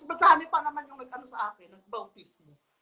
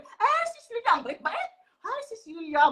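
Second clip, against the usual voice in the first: high, syrupy fake-friendly greeting; butter her up in a much higher voice; sugary sweet Sis Lynn; 9 semitones higher than usual. Mocking Sis Lynn's sweet phone greeting to Lilia, high and syrupy. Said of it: "parang ano, ba, uh, yeah, mabait, mabait".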